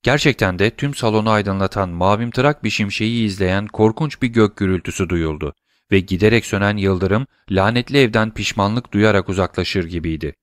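ordinary delivery, nothing marked

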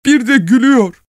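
very high, loud woman's cry; wife crying out. A narrator's reading. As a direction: wail the accusation. (ağlayan kadının çığlığı)

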